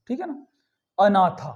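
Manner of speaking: ordinary talk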